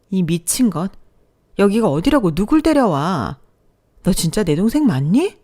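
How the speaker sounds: furious yelling. Acted. Sister yells at brother at the funeral, furious. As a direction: scold him for bringing his mistress.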